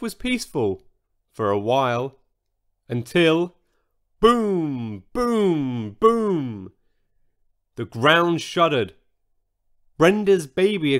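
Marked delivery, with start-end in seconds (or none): 4.2-6.7 drawn-out, resonant falling booms; booming footsteps. A narrator's reading. Storyteller sounds out heavy footsteps breaking the peace, booming. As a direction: let each boom ring out. New giant footsteps approaching.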